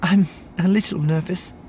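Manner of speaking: high, hesitant nervous voice. A narrator's reading. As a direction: admit nerves in a small high voice. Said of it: "You look nervous".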